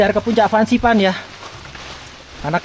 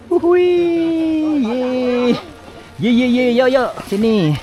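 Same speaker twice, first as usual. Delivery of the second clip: excited; 6 semitones higher than usual. Real, not acted. Reaching the summit station.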